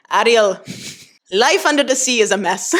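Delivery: botched Jamaican accent that slides toward Schwarzenegger; bad Jamaican accent. Shown as a demonstration. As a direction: attempt a Jamaican accent and miss it comically. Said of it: "trying to do a Jamaican accent".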